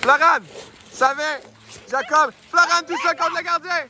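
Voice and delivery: high, rising breakaway call; excited commentary. Acted. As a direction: build the breakaway to the goal. Breakaway called live. (commentaire qui s'emballe)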